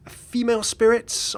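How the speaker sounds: ordinary talk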